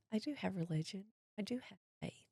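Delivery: hushed, humbled affirmation; acted-out quiet reply, very soft; subdued insistence. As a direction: insist softly, almost to yourself. Retold subdued answer to the lab girl.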